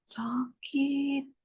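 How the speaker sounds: pained whisper; hushed mock pain; whispered ouch. Shown as a demonstration. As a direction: breathe it like a small hurt. Acting a hurt reaction.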